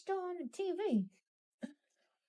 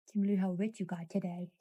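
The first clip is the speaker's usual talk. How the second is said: low loud pet tease; 7 semitones lower, 5 dB louder, faster than usual. Acted. Teasing the pet again.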